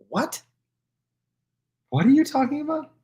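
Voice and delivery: high, incredulous mock questioning; baffled disbelief. Comic retelling reply to a caller asking for discount tickets, baffled. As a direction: question it as if you can't believe your ears.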